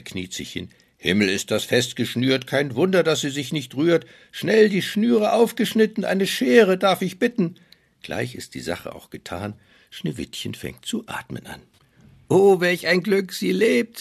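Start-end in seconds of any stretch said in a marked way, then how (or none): 1.0-7.6 high, loud frantic dwarf cries; panicked dwarfs. Acted. Dwarf cuts laces. 12.3-14.0 joyful dwarfs. Acted. Dwarfs rejoice she lives.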